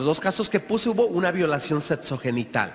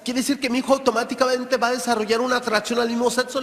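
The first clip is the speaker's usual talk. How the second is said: worried mother; 6 semitones higher than usual. Retold or imitated. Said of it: "muchas mamás preocupadas me dicen".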